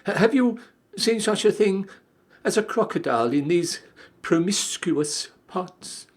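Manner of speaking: put-on high, pleading young elephant; a small curious child, complaining. Child asks the snake.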